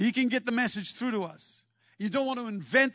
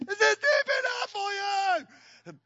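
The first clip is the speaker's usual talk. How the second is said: much higher, slow, mocking shout; sarcastic shout; 12 semitones higher, slower than usual. Peter shouts across the lake to Jesus, slow and mocking. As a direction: yell it across the water with sarcasm.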